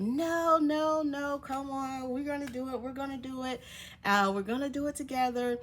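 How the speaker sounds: high-pitched imitation of cheering someone on; coaxing encouragement. Two ladies urging her back up, coaxing.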